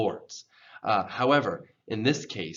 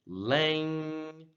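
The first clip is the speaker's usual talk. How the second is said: "lang" drawn out on a high, held tone; stressed first syllable; 6 semitones higher than usual. Shown as a demonstration. Teacher shows where emphasis goes, elongated high syllable. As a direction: raise and stretch "lang". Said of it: "emphasis goes on the first syllable".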